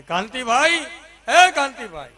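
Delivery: loud, high call across the street at night; wife yelling for the neighbour at two at night. Retold or imitated. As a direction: shout the neighbour's name from the window. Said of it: "સામે રાડ પાડી".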